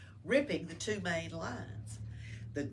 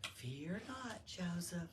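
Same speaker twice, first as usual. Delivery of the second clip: gentle comfort; 12 dB quieter, slower than usual. Acted. Mary comforts Joseph in story.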